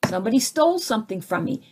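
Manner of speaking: ordinary talk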